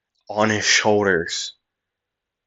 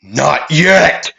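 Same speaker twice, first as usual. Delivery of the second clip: raised, defiant cry; defiant shout; 4 semitones higher than usual. Acted. Big guy standing up again, shouting in defiance. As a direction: refuse to stay beaten. Beaten villain rises and charges.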